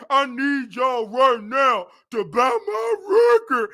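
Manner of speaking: slow, whiny mock plea; hammed-up singer begging; mock pleading singer.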